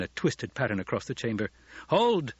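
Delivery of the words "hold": high, sudden shouted order; commanding shout. A narrator's reading. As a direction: shout to halt a battle. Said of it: "Paul shouted".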